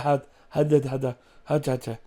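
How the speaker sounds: mock stammering, words stuck and restarting; flustered stammer. Actor forgets lines when embraced, stammering flustered. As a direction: imitate being lost for words.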